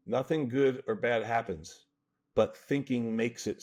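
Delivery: slow quoted line. Shown as a demonstration. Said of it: "this is the line from Hamlet".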